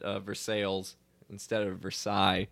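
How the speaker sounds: "Versailles" first as ver-SAILS, then as ver-SIGH; Kentucky vs French pronunciation. Shown as a demonstration. Explaining Kentucky's Versailles, local then French. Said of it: "how everyone in Lexington called it".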